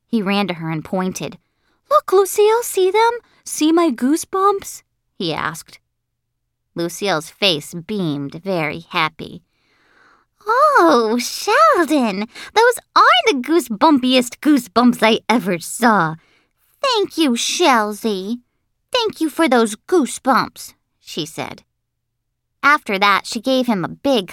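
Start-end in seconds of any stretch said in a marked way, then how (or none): 1.9-4.8 put-on very high, loud, excited boy; squeaky, overeager kid; eager little Sheldon. 10.5-20.7 high, gushing schoolgirl thanks; high and effusive, opening on a big oh; delighted, flattered girl. Acted.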